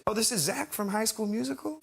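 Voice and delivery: high, light star impression. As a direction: lift the voice in sweet recognition. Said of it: "It's [name] again".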